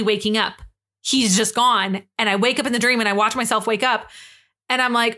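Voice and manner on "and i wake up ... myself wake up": ordinary delivery, nothing marked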